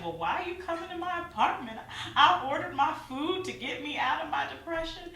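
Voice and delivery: high, laughing disbelief; bewildered and laughing at herself; incredulous. Retold or imitated. Confused by friend's visit, laughing disbelief. Retelling her confused reaction.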